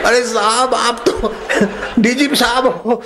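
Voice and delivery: high, laughing through every word; laughing reply. Acted. Constable telling the DGP off while giggling. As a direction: protest while giggling helplessly.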